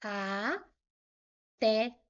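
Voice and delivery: "a, té" as two syllables with a long gap; separando em sílabas. Shown as a demonstration. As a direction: split the word into "a" and "té". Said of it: "Eu separo aqui". ("a, té" com pausa entre as sílabas)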